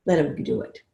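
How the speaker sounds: usual speaking voice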